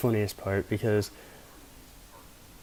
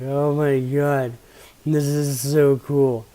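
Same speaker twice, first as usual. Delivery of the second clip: higher, slow amazed wonder; stoned awe; 3 semitones higher, 3 dB louder, slower than usual. Retold or imitated. Stoned teen on his road, slow amazed wonder. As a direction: marvel as if walking on Mars. High, walking like on Mars.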